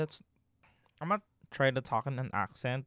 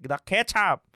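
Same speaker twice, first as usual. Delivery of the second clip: louder, higher mock insistence; grandpa's mock insistence; 6 semitones higher, 7 dB louder than usual. Grandson imitating grandpa explaining himself, loud mock insistence. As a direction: punch out "ketchup" like an insistent grandpa. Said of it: "And then he's like".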